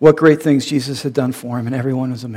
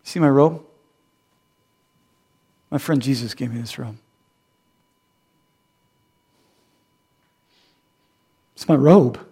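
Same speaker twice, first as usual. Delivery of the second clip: lightly voiced proud man, grinning; proud healed man. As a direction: show off the robe with a chuckle. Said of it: "he's going".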